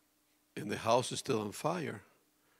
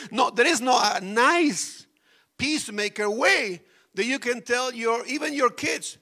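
normal talking voice

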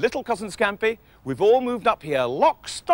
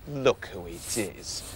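soft, slow and hushed; tender hush; 13 semitones lower, 8 dB quieter, much slower than usual. Acted. Matthew gently discovers crying Sooty behind the counter. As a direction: coax a sad little bear gently.

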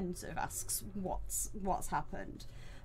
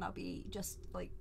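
aside; 8 dB quieter than usual. Real, not acted. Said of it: "taking my headphones off".

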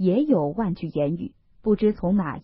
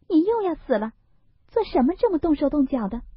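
put-on much higher, fast, pouting young lady's reproach; Daiyu scolding; 8 semitones higher, faster than usual. Daiyu stepping back, chiding Baoyu in a higher, quick voice. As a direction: push him off with a playful scolding.

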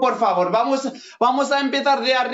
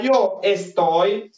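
slow teacher model; much slower than usual. Shown as a demonstration. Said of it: "هنا غتقولوها بهاد الطريقة".

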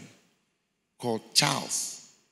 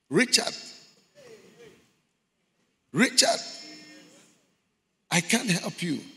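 much higher, loud anguished call; crying out; 13 semitones higher, 5 dB louder than usual. Retold or imitated. Man in hell calling to his friend, anguished cry.

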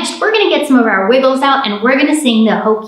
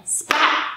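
breathy splat sound; whispered splat; 6 dB quieter than usual. Acted. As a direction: spit out the splat. Train hits the peanut.

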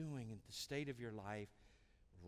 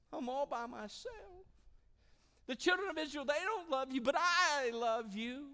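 high, loud, slow whine; whining Elijah; 11 semitones higher, 7 dB louder, slower than usual. Acted. Elijah crying out alone to God, whining high and loud. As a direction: wail self-pity to God. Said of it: "how many times have we whined".

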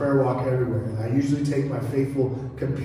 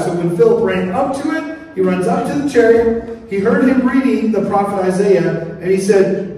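loud, raised preacher's voice; forceful preaching; 5 semitones higher, 7 dB louder than usual. Real, not acted. Preacher tells the congregation of Philip running up, forceful preaching. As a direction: drive the Bible story forward with urgency.

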